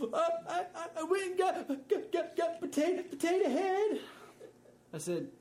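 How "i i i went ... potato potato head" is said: put-on higher, louder stammering child voice; crying toddler. Daughter confessing to dad: imitated crying child. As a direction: stutter through tears like a little girl.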